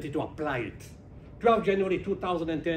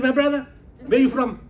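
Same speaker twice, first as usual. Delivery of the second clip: a much higher, louder hail to a stranger; loud friendly call; 9 semitones higher, 9 dB louder than usual. Retold or imitated. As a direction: call out warmly across the room. Calling out to a stranger.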